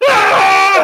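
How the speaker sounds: high loud fleeing shriek; hammed-up scream; mock scream. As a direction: shriek like running from fans. Running through crowds screaming.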